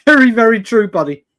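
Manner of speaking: usual speaking voice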